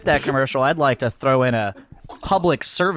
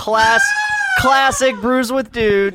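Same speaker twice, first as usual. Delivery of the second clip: announcer voice; 10 semitones higher, 5 dB louder, much slower than usual. Pretend. Announces show catchphrase.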